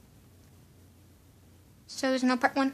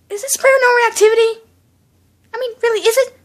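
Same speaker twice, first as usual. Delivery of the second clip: loud questions climbing higher to the last; alarmed questioning; 6 semitones higher, 11 dB louder, faster than usual. Real, not acted. Viewer asking if this is paranormal activity, alarmed questioning. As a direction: demand an answer, pitch rising with each repeat.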